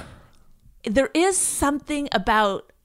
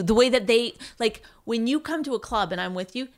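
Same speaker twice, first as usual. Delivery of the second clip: ordinary talk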